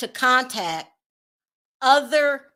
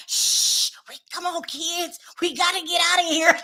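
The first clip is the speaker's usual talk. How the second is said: whispered shh then a high, hushed hurry; high, hushed mock escape; mock sneaking wife; 5 semitones higher than usual. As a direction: play the wife sneaking the kids out.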